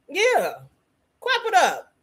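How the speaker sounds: high-pitched celebratory call; cheering on applause. Real, not acted. Host rallying the group to clap for Jazzy, high and celebratory.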